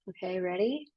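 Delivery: ordinary talk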